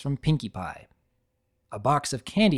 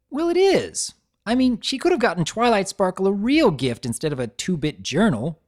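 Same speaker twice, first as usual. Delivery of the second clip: defensive; 7 semitones higher, 4 dB louder than usual. A narrator's reading. Rainbow justifies herself.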